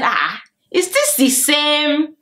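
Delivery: high, slow stunned disbelief; shocked. Retold or imitated. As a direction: draw it out in shock.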